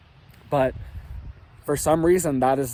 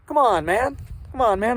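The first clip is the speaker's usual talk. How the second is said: high-pitched Biden impression, louder; 8 semitones higher, 4 dB louder than usual. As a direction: play a hammed-up Biden scolding. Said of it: "like Biden".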